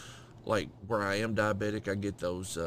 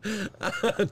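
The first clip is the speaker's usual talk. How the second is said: sudden high-pitched laugh; high burst of laughter; 11 semitones higher, 4 dB louder than usual. Real, not acted.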